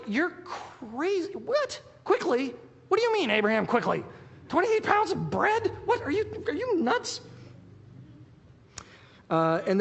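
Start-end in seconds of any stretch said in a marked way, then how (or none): 0.1-7.2 high, squeaky mock outrage; Sarah in disbelief. Sarah scolding Abraham over the flour, in high mock disbelief. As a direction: play Sarah gasping that he's lost his mind. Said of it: "And she's just like".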